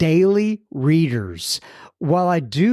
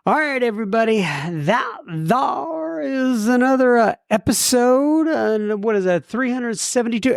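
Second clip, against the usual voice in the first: high, drawn-out podcast outro; pitched-up host wrapping up the show; show sign-off; 6 semitones higher than usual. Real, not acted. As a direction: sign off with slow, stretched-out phrases. Signs off the podcast episode.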